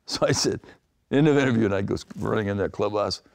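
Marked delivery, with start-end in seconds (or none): none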